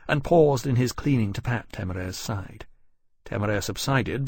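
ordinary delivery

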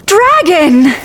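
high, gasping, delighted call; joyful reunion cry; overjoyed cry. Acted. Joy at dragon waking.

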